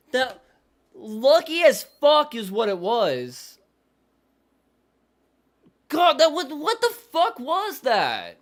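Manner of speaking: yelling at the TV over a dumb play call; angry yelling. Real, not acted. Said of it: "make me lose my fucking voice".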